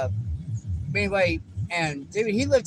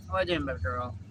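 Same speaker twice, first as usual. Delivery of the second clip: usual speaking voice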